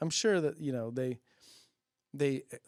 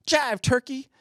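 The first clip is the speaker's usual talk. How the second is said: mock jive insult; 10 semitones higher, 4 dB louder, slower than usual. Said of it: "throw in you".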